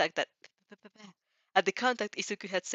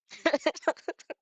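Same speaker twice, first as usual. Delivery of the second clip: real laugh; 9 semitones higher, 4 dB louder than usual. Real, not acted. Reader laughs at "Am I?.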